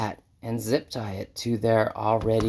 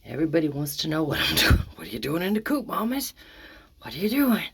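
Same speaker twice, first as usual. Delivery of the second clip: higher, lilting voice for the chickens; pet talk to hens; 6 semitones higher than usual. Real, not acted. Asking curious hens in the coop, high pet talk. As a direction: gently ask the hens what they're doing.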